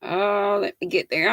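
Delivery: ordinary talk, nothing marked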